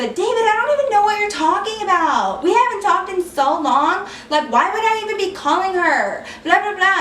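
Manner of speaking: high-pitched mocking whine; exaggerated high, pleading denial; feigned innocence. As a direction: mimic the ex protesting innocence. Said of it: "of course she pulls the".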